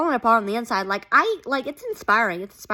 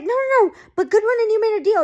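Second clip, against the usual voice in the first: high, fast, urgent no; a retold desperate denial; frantic protest; 10 semitones higher, 3 dB louder, faster than usual. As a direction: rush the objection out in a high voice. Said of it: "But she's like".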